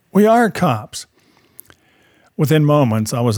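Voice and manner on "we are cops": raised-pitch mock holler; imitated holler. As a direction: call it out as the arriving cop, without full volume.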